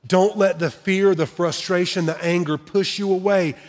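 ordinary talk

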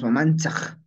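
Mamanchaj said "ma-MAN-tsaj"; Huaylas variant. Shown as a demonstration. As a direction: end Mamanchaj in tsa plus a back-of-throat fricative. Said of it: "podemos pronunciar esta forma".

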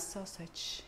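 sausage whispered, unvoiced. Shown as a demonstration. Cook playfully whispers the English word sausage. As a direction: breathe the word sausage almost silent. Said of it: "A mí me gusta así más".

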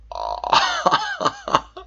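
high disbelieving laugh; delighted shriek of laughter; squealing laugh. Real, not acted.

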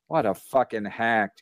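ordinary delivery

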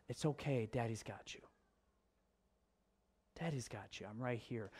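put-on soft, hushed comfort to a crying little girl; gentle fatherly hush; soothing a child. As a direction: murmur it like holding her close. Dad comforts crying daughter.

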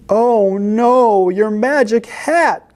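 high, drawn-out alarm; dismayed cry. A narrator's reading. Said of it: "cried the cats".

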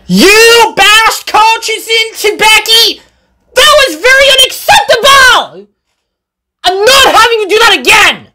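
very high, outraged telling-off; worked-up boss reprimanding an engine; angry scolding. A narrator's reading. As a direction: scold indignantly, drawing out the first words. Boss tells Sam off after crash.

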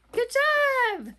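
high, loud "well done" cheer; cheering praise. Real, not acted. Praising children after the song.

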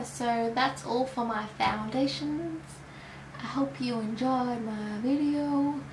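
put-on poor American accent. Vlogger signing off to viewers in a poor American accent. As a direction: sign off like a half-hearted American YouTuber. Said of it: "I don't know why I'm talking like American".

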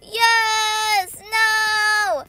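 loud, high drawn-out cries; game outburst. Real, not acted. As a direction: shout triumph then dismay. Reacting to fight swings.

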